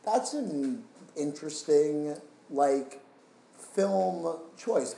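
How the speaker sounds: mildly intrigued, drawing the thought out; musing surprise. Retold or imitated. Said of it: "I was like".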